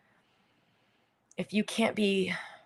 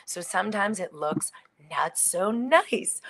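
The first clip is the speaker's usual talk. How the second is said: ordinary talk, nothing marked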